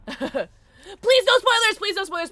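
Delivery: high, slow, loud plea; loud pleading. Real, not acted. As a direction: beg the chat loudly. Pleading to chat.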